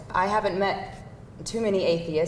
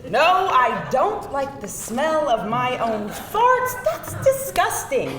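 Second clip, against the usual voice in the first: laughing denial; 4 semitones higher, slower than usual. Acted. Laughing denial of gross question.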